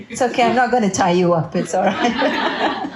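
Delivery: real chuckle through the words; amused, teasing let-off; laughing reassurance. Real, not acted. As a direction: reassure them, laughing at your own joke. Laughing reply to audience remark.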